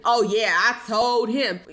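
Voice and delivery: loud, slow mocking boast; mock defiance. Commentator imitates the mentality she is sick of, mocking. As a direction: drag it out loudly, mocking the defiance.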